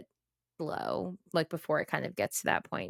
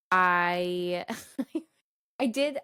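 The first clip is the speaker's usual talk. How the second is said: normal talking voice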